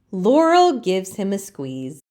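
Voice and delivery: ordinary delivery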